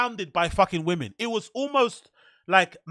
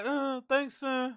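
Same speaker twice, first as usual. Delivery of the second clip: through clenched teeth; 5 semitones higher, 8 dB quieter, much slower than usual. Retold or imitated. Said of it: "talks to you, like, with their teeth clenched".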